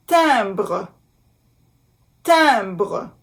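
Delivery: "Timbre" in French, nasal "tim-", uvular r; careful French pronunciation. Shown as a demonstration. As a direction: model "Timbre" with a nasal vowel. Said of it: "I M".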